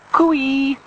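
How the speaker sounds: high, loud singled-out call; high "cooey" call across a distance; distant calling. A narrator's reading. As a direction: hail a friend from far off. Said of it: "hears her voice some distance away calling".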